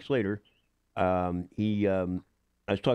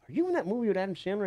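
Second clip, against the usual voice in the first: imitating woman; 11 semitones higher, faster than usual. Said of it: "she goes".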